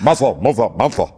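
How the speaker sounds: a hint of Barack pacing on "Mansa Musa Mensa"; Barack impression. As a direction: do your dumb Barack. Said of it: "your dumb Barack".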